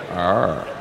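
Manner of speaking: deep, dull viewer's groan; low "arr" groan. Acted. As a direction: let out a low, unimpressed "arr". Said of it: "on the screen and you go".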